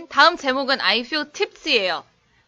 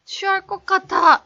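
취한 연기; 8 semitones higher, 5 dB louder than usual. Pretend. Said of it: "저 오늘 취하는 연기 좀 되는 것 같은데요".

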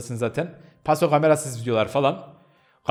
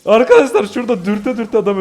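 laughing; 5 semitones higher, 5 dB louder than usual. Real, not acted. Laughing at teammate's death.

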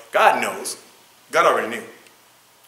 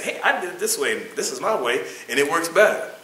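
higher-pitched mock boasting; boastful claim; 7 semitones higher, 5 dB louder than usual. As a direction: proudly claim the credit, pitched up. Acts someone claiming credit.

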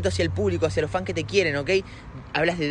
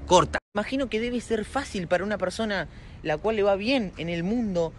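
ordinary talk

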